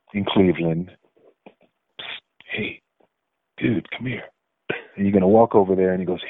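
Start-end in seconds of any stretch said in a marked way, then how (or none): 2.5-4.2 hushed shady call from an alley. Retold or imitated. Shady alley dealer calling you over.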